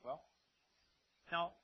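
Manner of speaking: usual speaking voice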